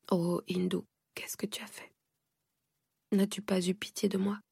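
hushed, breathless reproach; anguished whisper. Acted. Mother whispering to her runaway daughter, pained. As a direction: whisper it as if it hurts to say.

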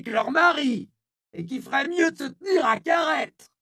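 put-on high, loud hoarse old woman's shout, slowing; hoarse powerful yell. A narrator's reading. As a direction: yell down the crowd like a town crier. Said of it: "de sa voix rauque mais puissante". (la vieille Isia hurle à la foule, voix rauque et puissante)

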